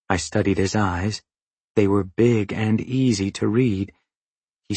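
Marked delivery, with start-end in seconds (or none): none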